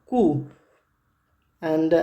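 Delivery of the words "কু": "কু" with a plain "উ" and no English "ইউ"; German Q. Shown as a demonstration. Teacher models the German letter Q for students, "কু". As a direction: say "কু", short and round. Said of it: "German ভাষায় উচ্চারণ করবো তখন হবে".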